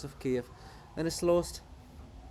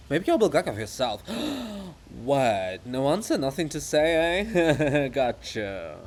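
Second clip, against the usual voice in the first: taunting; 7 dB louder, slower than usual. Acted. Chorus taunts the statue.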